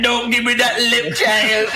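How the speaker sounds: put-on comic Jamaican-accented reprimand; Jamaican teacher. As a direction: imitate a Jamaican teacher telling off a child. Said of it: "get in my face and be like".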